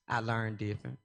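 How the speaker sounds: normal talking voice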